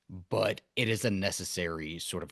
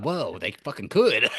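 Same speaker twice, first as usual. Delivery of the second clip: mind blown; 5 semitones higher, 9 dB louder than usual. Retold or imitated. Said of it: "my brain exploded".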